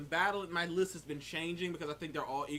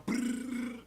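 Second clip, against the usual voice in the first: high rolled creature growl; 8 semitones higher than usual. Retold or imitated. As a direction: growl like a beast. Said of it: "these creatures go".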